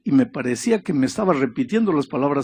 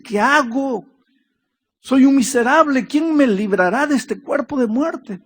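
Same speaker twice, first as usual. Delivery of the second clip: higher, louder anguished cry of despair; 11 semitones higher, 4 dB louder than usual. Retold or imitated. Paul crying out over his sin, anguished high cry. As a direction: cry out like a man at the end of himself. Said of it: "y grita y dice".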